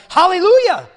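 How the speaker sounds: very loud, high cry of praise; full-force jubilant yell; exultant shout. Acted. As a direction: shout it like a great crowd. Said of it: "a multitude in heaven shouting".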